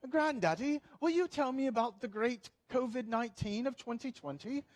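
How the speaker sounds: put-on British accent of a curious grandchild. Grandchild asking granddaddy about covid history, British accent. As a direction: ask sweetly in a mock British child's accent. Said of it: "my grandson's gonna have a British accent".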